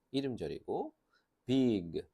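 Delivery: ordinary talk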